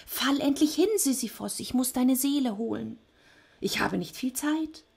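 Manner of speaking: high-pitched, thin creature voice; impatient Death. A narrator's reading. Death waits for Sisyphos to faint, impatient. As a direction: urge him on in a high, impatient voice.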